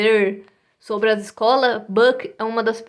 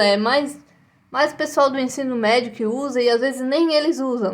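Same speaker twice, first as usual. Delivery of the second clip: ordinary talk, nothing marked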